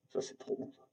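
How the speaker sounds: hushed whisper of delight; whispering how good game meat is; whispered aside. Real, not acted. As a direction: breathe it out to yourself, savouring. Repeats the praise under her breath.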